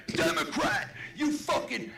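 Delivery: hammed-up hostile label; mock insult. Shown as a demonstration. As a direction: spit it out as an over-the-top accusation. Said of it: "I didn't mean to be like".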